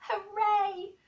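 put-on healthy boy's joyful shout; shouted hooray. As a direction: cheer it out. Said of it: "would probably have shouted".

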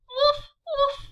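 put-on bouncy high puppy barks for kids. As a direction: bark like a little puppy. Dog barks in picture book.

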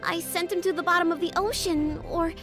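high-pitched anxious fretting; worried. Acted. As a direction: fret over a missing friend. Worrying about a lost friend.

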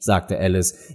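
usual speaking voice

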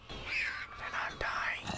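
slow, drawn-out whisper; tense whisper. Real, not acted. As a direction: whisper it under your breath, stretched out. Fretting over mobs not dying.

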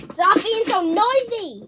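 high, loud, drawn-out complaint; exasperated scolding. Real, not acted. Vlogger fed up with noisy stuff, loudly scolding it. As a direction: scold it loudly, stretching the words.

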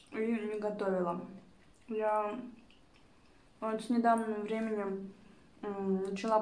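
talking between bites; muffled by food; mouth full, chewing. Real, not acted. Seen 1: takes a forkful mid-sentence, chewing through the words.

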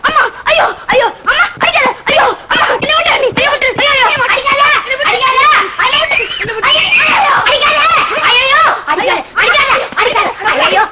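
high, repeated wails of pain; hammed-up crying and pleading; yelling under a beating. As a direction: howl and beg not to be hit. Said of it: "என்னடா கத்துற?".

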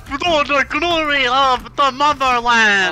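put-on high, loud, slow Russian accent; mock Russian accent. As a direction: proclaim it like a hammy Russian patriot. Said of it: "people who try to be like".

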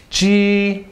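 G held high and level; first tone. Shown as a demonstration. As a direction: keep G on a steady high note. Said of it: "first tone".

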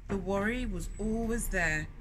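put-on storyteller reading to children; story narration. A narrator's reading. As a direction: read it like a picture book to little ones. Reads the picture book aloud.

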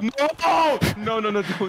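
loud high wail, then rapid noes; frantic pleading, easing off; panicked protest. Real, not acted. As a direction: cry out no in game panic. Begs as the match turns.

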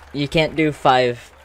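normal talking voice

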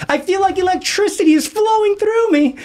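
very high, strained mock cry; overwhelmed student. Shaking student tells his teacher what he feels, overwhelmed. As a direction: imitate a kid jolted and overcome, way up high. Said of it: "starts shaking and vibrating violently".

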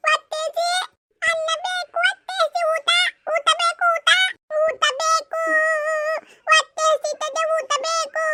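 put-on high, whiny little child begging for food; small child whimpering to mother for food; hungry child. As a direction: whine for food like a small kid.

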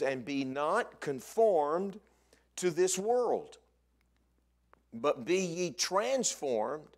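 emphatic pulpit reading; preaching scripture. Acted. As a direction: proclaim the verse phrase by phrase, weighty pauses. Declaims scripture.

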